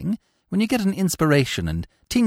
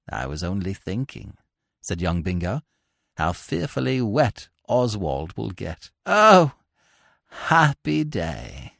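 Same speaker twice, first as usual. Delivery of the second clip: put-on slow, lower, sighing young man; lightly voiced long-suffering suitor; weary lovesick Bingo; 10 semitones lower than usual. A narrator's reading. Lovesick Bingo sighs to Bertie about loving the kid, slowly.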